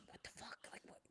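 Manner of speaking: whispered alarmed curse under the breath; hushed whisper. Acted. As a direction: hiss it so the guards won't hear. Agents react in hushed panic.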